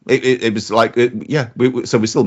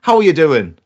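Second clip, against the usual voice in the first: a lightly done Irish lilt on a friendly greeting; mild Irish security guard accent; 5 semitones higher, 5 dB louder than usual. Retold or imitated. Security guard greeting the trespasser, light Irish accent. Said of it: "an Irish twang about him".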